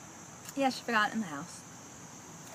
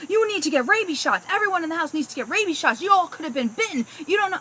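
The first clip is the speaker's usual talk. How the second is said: much higher, louder alarmed warning; panicky pest guy; 9 semitones higher, 7 dB louder than usual. Retold or imitated. Pest control man warns the customer about a dangerous bat, high and alarmed. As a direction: oversell the danger like a scare-mongering salesman. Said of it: "And he's like".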